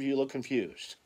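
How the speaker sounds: usual speaking voice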